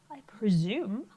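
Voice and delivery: very proper British accent. Shown as a demonstration. Teacher playfully says the word for class, British accent. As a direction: put on a prim and proper British voice. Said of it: "say it with a British accent".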